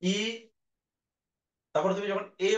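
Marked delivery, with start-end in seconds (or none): 0.0-0.2 a single ই held for students to copy; vowel demo. Shown as a demonstration. Teacher shows how ই is said. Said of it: "ই উচ্চারণ করলে দেখো".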